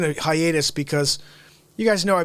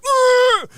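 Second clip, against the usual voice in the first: mock grunt yell; 9 dB louder than usual. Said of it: "You couldn't even be like".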